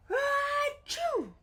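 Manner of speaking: loud high bear sneeze; big sneeze. Acted. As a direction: let out a huge achoo.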